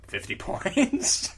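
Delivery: cracks up reading the number, slowly; laughing. Real, not acted. Laughing mid-read at the silly award. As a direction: giggles through it.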